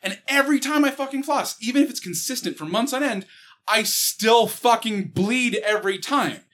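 ordinary delivery

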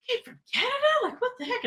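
very high, incredulous questions; imitating someone astonished; astonished disbelief. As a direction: squeak it in amazement. Band amazed at her long drive.

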